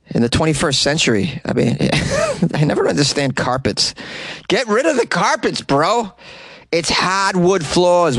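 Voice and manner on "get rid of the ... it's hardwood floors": higher, slow, teasing advice; playful bro scolding; mock lecture. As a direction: talk down to carpet owners as a bro. Lecturing listeners about carpets.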